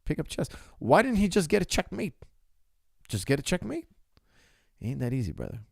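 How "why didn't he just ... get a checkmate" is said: higher, louder mocking question; mock critic. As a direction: mimic a fan who thinks chess is easy.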